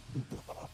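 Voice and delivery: soft, voiceless villain chuckle; short, hushed gloating laugh; sinister chuckle. Acted. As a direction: quietly laugh at their hidden fear. Villain savors hidden fear.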